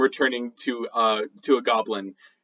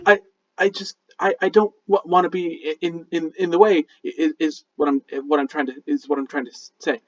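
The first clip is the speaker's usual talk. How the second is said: halting, repetitive stammer; nervous stutter, starting high and petering out; flustered explanation; 4 semitones higher, faster than usual. Acted. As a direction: trip over every phrase, raised voice fading into a mumble. Flustered goblin tries to explain himself.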